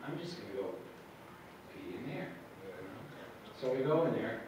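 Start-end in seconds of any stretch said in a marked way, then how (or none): 0.1-2.3 quiet thought. Retold or imitated. Private thought about bathroom.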